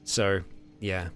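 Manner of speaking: normal talking voice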